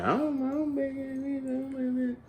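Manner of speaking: high, soft mocking imitation; squeaky, timid put-on voice; mock hesitant reply. Mocking the guy's weak shrug of an answer, high and soft.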